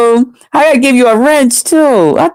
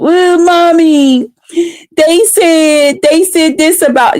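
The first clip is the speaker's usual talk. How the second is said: put-on slow, higher little-kid whine to mommy; whiny child; 6 semitones higher, slower than usual. Mocking haters as a nine-year-old whining to mommy. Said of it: "like a little nine-year-old".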